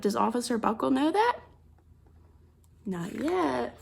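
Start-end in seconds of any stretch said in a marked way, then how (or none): none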